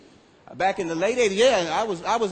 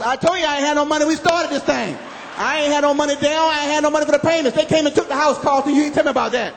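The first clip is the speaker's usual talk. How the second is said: louder, higher furious complaint; angry ranting; 7 semitones higher, 6 dB louder than usual. Retold or imitated. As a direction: blame Carlton at full volume.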